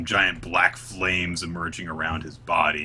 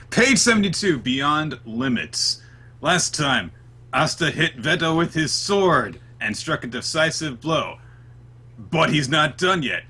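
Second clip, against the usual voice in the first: higher, punched episode announcer; dramatic recap announcer; 7 semitones higher than usual. Acted. Host opens the chapter with last week's recap, dramatic announcer voice. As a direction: announce it like a big previously-on intro.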